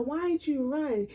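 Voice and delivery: usual speaking voice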